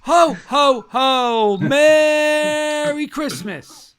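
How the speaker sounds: loud, high, very slow Santa; booming drawn-out Santa greeting; jolly ho ho ho. Pretend. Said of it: "We need to hear your best ho, ho, ho".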